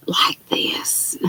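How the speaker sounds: whispered very softly, showing how quiet; hushed whisper. Shown as a demonstration. Host showing viewers how she must talk, whispering. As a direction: drop to a bare whisper. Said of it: "gonna have to talk really".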